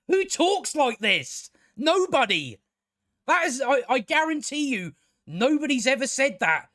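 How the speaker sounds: high, exasperated disbelief; appalled, insistent comic outrage; incredulous rant. Real, not acted. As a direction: protest it with pitch way up. Ranting at absurd dialogue.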